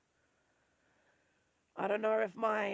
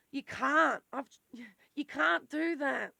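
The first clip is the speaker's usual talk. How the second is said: CAN'T punched loud and high; ranting insistence; emphatic can't; 4 semitones higher, 6 dB louder, slower than usual. Real, not acted. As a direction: hit CAN'T hard. Emphatic rant.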